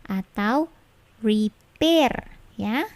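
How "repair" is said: very slow "repair", gap before an airless P; "repair" split as "ri... per"; wrong non-plosive P. Shown as a demonstration. Teacher showing another wrong repair, unaspirated P. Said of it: "yang benar dan yang salahnya".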